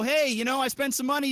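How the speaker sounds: ordinary talk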